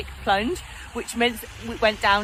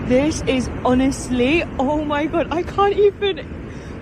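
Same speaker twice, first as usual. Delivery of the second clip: overwhelmed amazement; 5 semitones higher, 5 dB louder, slower than usual. Real, not acted. Overwhelmed by the icy view.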